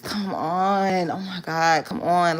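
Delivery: mock impatient nagging, drawn out; trying to rush. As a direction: imitate an exasperated boyfriend hurrying her along. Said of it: "trying to rush me".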